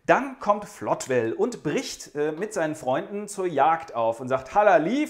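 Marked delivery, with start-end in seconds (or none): 4.5-5.1 hunting call. Acted. Hunter calls out to friends.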